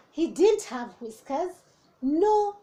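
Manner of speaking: ordinary delivery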